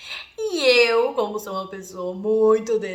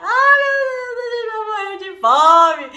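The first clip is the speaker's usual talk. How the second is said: hammed-up panic; 10 semitones higher, 8 dB louder, slower than usual. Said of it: "eu sempre fui muito cômica".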